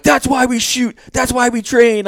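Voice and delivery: very high, loud mock scream; strained high holler, sending himself up; corny triumphant yelling. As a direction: reenact a cheesy victory cry. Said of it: "I said something stupid fucking corny".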